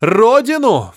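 the doctor roars back at Шмель. A narrator's reading. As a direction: bellow the word back in outrage. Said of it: "взревел доктор".

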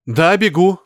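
exclamation. A narrator's reading. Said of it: "воскликнул доктор".

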